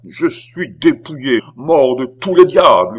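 howled curse. A narrator's reading. Beausire rages over his losses, howling. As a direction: bellow it in outrage. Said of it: "hurla Beausire".

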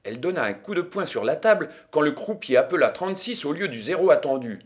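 ordinary delivery

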